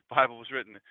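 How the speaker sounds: ordinary delivery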